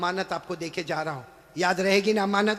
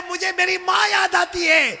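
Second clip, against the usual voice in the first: impassioned longing; 10 semitones higher, 4 dB louder than usual. Acted. Prophet's longing, preached loud.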